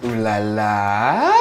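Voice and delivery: slow, drawn-out ooh la la; sassy ooh la la. Real, not acted. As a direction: purr it like a diva. Host admires the room.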